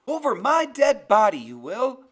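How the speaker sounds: big, high, furious shout; tearful yelling. A narrator's reading. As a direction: yell it at full voice, close to tears. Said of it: "That's Graham yelling, and her voice is big and full of tears".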